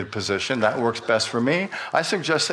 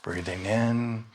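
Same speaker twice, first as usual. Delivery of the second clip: soft, slow breathing cues fading lower; guided meditation; 3 semitones lower, 6 dB quieter than usual. Acted. Pastor guiding the church in breathing, soft and slow. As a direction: guide them gently through each breath.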